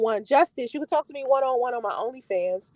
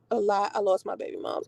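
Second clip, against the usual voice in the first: normal talking voice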